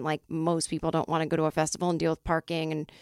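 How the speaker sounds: ordinary delivery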